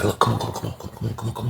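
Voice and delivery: soft, hurried come-on under the breath; hushed urging. Retold or imitated. Inmate hushing a guard into his cell, soft urgent whisper. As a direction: wave him in quietly, almost whispering. Hurrying Tom into cell quietly.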